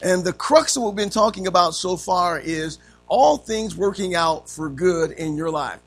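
usual speaking voice

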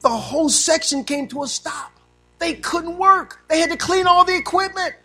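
preaching at full force. Acted. Preacher building to a loud, high climax. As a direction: pound it out to the congregation.

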